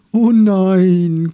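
a loud, high wail of dismay; lamenting. Acted. The swaying tree wails loudly over its leaves. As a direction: cry out in loud woe. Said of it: "klagte er".

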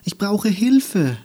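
etwas lauter, höher bittend; drängender bitten. Acted. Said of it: "nun lauter". (Andi bittet das schnarchende Wesen lauter um Hilfe)

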